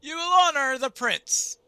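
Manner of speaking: high, very loud rebuke; commanding shout. Acted. Dwarf ordering a restless crowd, high commanding shout. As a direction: shout down the dissenters.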